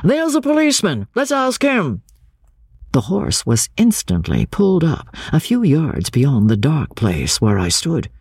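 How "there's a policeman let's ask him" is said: high, excited call to a companion; crying out. A narrator's reading. Man in chaise spotting a policeman, crying out. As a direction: call it out in a raised pitch. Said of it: "cried one".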